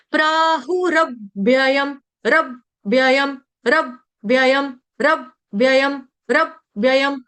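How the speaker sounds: slow रव्ययम, closing on रव then व्ययम; half व held briefly in रव्ययम; careful pronunciation drill. Shown as a demonstration. Teacher drilling the joined letter, slow split pronunciation. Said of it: "मैं कैसे उच्चारण कर रही हूँ".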